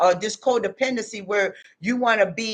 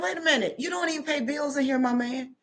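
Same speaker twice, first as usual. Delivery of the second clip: mock stern mom; 5 semitones higher, 5 dB quieter than usual. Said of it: "I was like".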